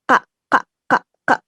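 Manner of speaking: "kak" clipped with a tight throat catch; tense kak. Shown as a demonstration. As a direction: jerk the throat on each "kak". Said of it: "diberikan sentakan pada tenggorokan". ("kak" dengan sentakan tenggorokan)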